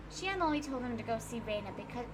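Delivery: ordinary talk, nothing marked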